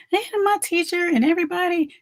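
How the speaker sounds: high-pitched imitation of an upset child; complaining daughter. Daughter complaining to mother about class, mock childish protest.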